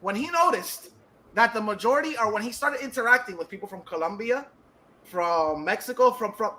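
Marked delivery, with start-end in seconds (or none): none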